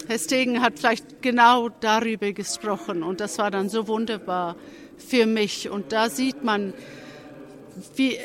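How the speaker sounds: ordinary talk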